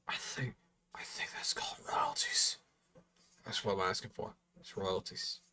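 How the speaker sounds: very soft, near-whispered aside; hushed aside. Pretend. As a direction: drop to a whisper, as if confiding. Sly aside asking for royalties.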